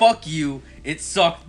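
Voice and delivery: loud, half-joking fuck you; mock angry outburst. Snapping back at Thomas after the credits, loud mock anger. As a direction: bark it at him, playfully annoyed.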